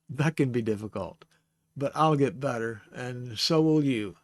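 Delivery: ordinary delivery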